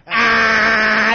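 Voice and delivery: loud mock maniacal laugh; screaming clown cackle. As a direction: screech a jeering laugh at the target. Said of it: "he's just screaming at him".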